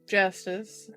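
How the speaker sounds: plain reading voice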